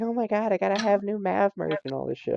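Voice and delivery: put-on freaking-out kid. Imitating kids begging for new merch, freaking out. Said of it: "The kids are, like, freaking out".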